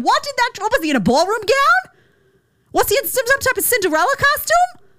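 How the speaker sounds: scoffing at a ballroom gown; mocking disbelief. Real, not acted. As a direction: ridicule the outfit in high squeals. Mocking incredulous questions.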